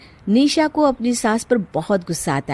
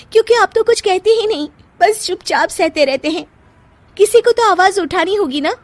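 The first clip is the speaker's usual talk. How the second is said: indignant; 12 semitones higher, 4 dB louder than usual. Acted. Wife protesting to husband.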